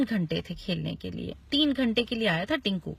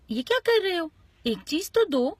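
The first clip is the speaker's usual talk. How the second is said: higher-pitched parental reproach; scolding father; 7 semitones higher, 3 dB louder than usual. Acted. Father tells Tinku to share, scolding. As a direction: chide a child, voice pitched up.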